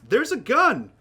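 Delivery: loud, high cry of alarm; panicked public shout. Acted. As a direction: shout a warning across a crowded room. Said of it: "and then shouts".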